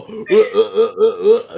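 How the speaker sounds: loud, repeated choking grunts; mock dying gasps. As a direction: imitate someone choking to death, loudly. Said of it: "died of covid".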